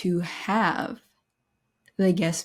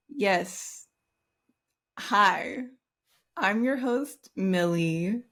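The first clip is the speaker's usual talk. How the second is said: ordinary delivery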